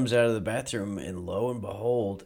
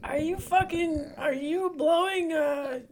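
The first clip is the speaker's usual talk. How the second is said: put-on squeaky falsetto child voice; mock grandkid; slower than usual. Grandkid pestering Grandpa with questions, squeaky falsetto. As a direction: put on a very high little-kid voice. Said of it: "Your grandkids say".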